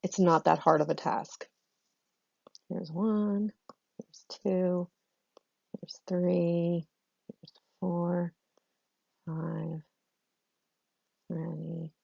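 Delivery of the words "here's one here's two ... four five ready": counting under the breath; hushed counting while marking text; whispered counting. Real, not acted. Counting syllables quietly while highlighting.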